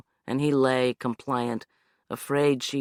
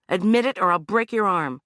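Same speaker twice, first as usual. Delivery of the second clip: hard demand; 6 semitones higher, 5 dB louder than usual. Acted. Anna demanding confession.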